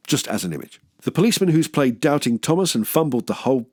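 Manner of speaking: normal talking voice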